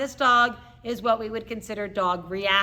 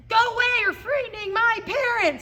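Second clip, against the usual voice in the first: high-pitched, frantic dog voice; upset reactive dog; 11 semitones higher, 4 dB louder than usual. Pretend. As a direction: play an alarmed dog shooing off a threat. Voices upset dog protecting its owners.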